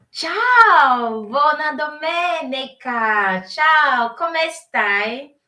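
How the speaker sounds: loud, high, bright hello to the chat; excited greeting. Real, not acted. Host greeting arriving viewers on a Sunday live, loud and excited. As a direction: call out a big happy welcome, slowing on the second word.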